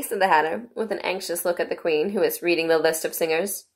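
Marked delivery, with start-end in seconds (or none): none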